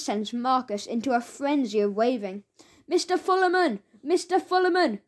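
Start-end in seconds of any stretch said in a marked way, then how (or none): 2.9-5.0 calling out. Acted. Marcus frantically waving for attention.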